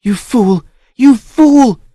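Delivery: high, loud scream rising on the repeat. Acted. Niece screams at her uncle in fury. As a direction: scream it at him, beside yourself. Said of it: "screamed Balesa".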